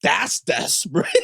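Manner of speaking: disapproving wife, retold. Wife judging whoever flirted at a funeral, mock disapproval. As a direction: draw out her verdict, half laughing. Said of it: "Why you gotta say it like that".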